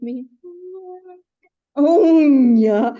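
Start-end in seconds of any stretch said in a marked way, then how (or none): 1.8-2.9 loud exasperated oh; road rage outburst. Retold or imitated. Driver recalling road rage, loud exasperated outburst. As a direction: blurt it like a driver boiling over.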